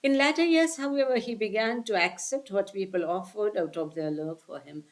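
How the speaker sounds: slow, careful read of a scripture passage; measured recitation of the text; slow reading aloud. Acted. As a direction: read each phrase deliberately to the listeners. Reads the English text slowly.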